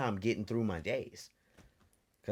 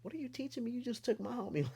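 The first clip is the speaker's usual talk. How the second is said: put-on much higher, fast, indignant child voice; whiny kid; faster than usual. Boy protests to his dad, high whiny indignation. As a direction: protest like a wronged little boy. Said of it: "I was like".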